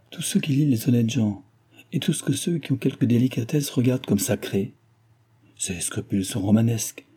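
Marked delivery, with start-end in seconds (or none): none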